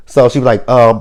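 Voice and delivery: usual speaking voice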